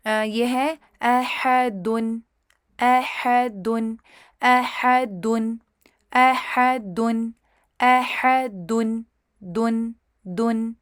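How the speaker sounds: احدن slow, final دن with rounded u; rounded lips. Shown as a demonstration. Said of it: "ہونٹوں کو گول".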